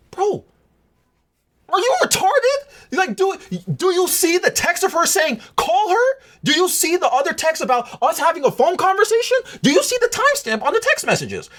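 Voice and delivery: hammed-up outrage at someone dense; high mock-exasperated questions; exasperated disbelief. Exasperated at the guy's reply.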